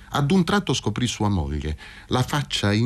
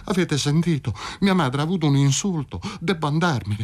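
higher, urgent worried telling; anxious alarm; 6 semitones higher than usual. A narrator's reading. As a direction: hurry to explain the mother's stroke. Wife alarmed, telling neighbours. (agitata e allarmata, voce più alta)

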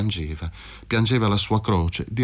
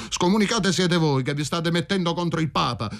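higher, defiant retort; shouting back; 7 semitones higher than usual. A narrator's reading. Michele yelling back at the women, higher defiant retort. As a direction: throw the insult back at them. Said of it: "gridò Michele".

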